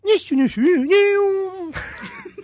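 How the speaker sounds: high, slow cartoon dwarf squeak; Disney dwarf voice. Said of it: "ディズニー風に".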